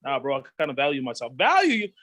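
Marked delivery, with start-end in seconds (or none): 1.4-1.9 high, loud mocking disbelief with a laugh; mocking peer. Mocking friend jeers at the virgin, high and loud. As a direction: scoff like a teasing homeboy.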